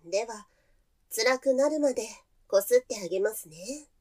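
normal talking voice

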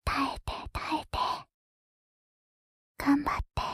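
breathy whisper into the ear. Acted. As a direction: whisper it playfully, close up. ASMR whisper.

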